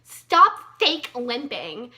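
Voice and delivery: put-on a stern teacher yelling at a limping kid; mock teacher yell. Teacher yells at limping student: slow loud scold. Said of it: "my teacher yells at me".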